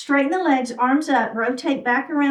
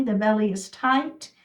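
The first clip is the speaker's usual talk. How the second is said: normal talking voice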